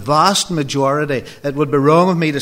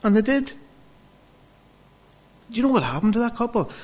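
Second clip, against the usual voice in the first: much higher, the question rushed out; amazed reveal; 10 semitones higher, faster than usual. Real, not acted. Preacher telling congregation, excited higher reveal. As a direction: build wonder at what God did.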